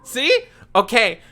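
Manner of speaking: high, loud and thrilled; excited. Real, not acted. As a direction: squeal with delight about it. Gushing over bounce feature.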